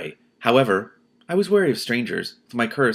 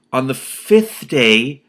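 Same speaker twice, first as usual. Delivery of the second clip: very slow and louder; dramatic slow; 3 semitones higher, 7 dB louder, much slower than usual. A narrator's reading. Reader building doom on the fifth day, very slow. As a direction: drag it out like doom.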